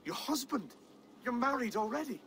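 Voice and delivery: it catches him off guard, voice jumps up; surprised. Acted. Lord shocked by Valdis's news.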